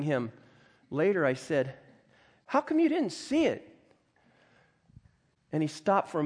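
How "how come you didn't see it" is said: high-pitched, puzzled retold question; incredulous question. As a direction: ask it high, baffled at his answer. Asks him in disbelief afterwards.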